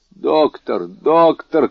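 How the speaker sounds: urgent high calls for the doctor; crying out. A narrator's reading. Servant feeling a new fit, crying out to the doctor. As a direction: call out in rising alarm. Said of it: "крикнул Баруа".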